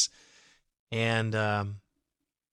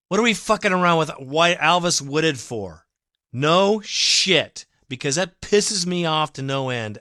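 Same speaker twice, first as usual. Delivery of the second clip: raised, heated rant; angry outburst; 4 semitones higher, 6 dB louder than usual. Real, not acted. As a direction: let the frustration boil over. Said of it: "I get a little emotional".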